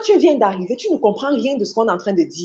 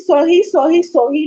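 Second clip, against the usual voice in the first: higher, quick flustered sorry sorry; mock nervous apologies; 8 semitones higher, 4 dB louder than usual. Preacher imitates the learner still saying sorry, mildly high. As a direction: echo the learner's embarrassed apologizing.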